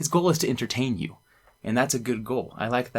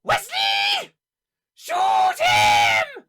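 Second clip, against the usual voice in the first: high shouted call, much higher; yelling; 5 dB louder, much slower than usual. Retold or imitated. Friend yelling at Wesley in paintball, high shout. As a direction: yell it high and urgent. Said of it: "And he goes".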